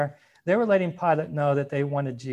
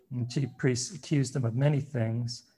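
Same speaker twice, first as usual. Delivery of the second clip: ordinary talk, nothing marked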